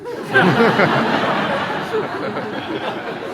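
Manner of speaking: laughing hard at the irony; loud burst of laughter. Real, not acted.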